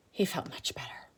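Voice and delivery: hushed relief; whisper. Acted. Teacher whispering the relief to children. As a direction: breathe it out softly.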